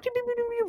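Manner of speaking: high, soft pew-pew sound effect; mock typing noise. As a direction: imitate furious tapping. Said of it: "So I'm going, like".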